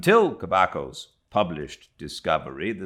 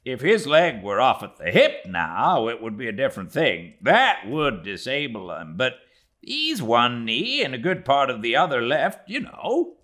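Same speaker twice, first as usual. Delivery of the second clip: a hint of a young mate, louder; Flask, lightly voiced; 6 semitones higher, 4 dB louder than usual. A narrator's reading. Flask replies to Stubb about Ahab, lightly voiced and louder. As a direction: Flask answers back, mildly played. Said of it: "said Flask".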